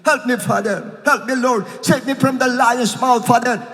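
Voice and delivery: put-on child's soul calling to father; child crying for help. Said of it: "Kaya sumisigaw yan".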